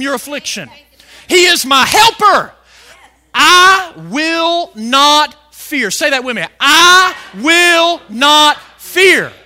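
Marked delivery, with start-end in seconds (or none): 1.3-5.9 loud, slow, emphatic proclamation; bold declaration. Shown as a demonstration. Preacher declaring trust in God as helper, boldly. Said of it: "we may boldly say". 6.6-9.3 very slow, loud, word by word; bold declaration. Shown as a demonstration. Preacher leading congregation to say it with him, boldly. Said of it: "Why can you say this boldly?".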